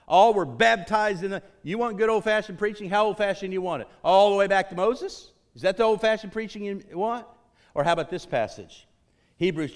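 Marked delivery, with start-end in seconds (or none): 0.1-7.3 high, raised pulpit challenge; fired-up preacher throwing out rhetorical questions; full-force preaching. Real, not acted. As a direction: press the congregation with loud, high questions.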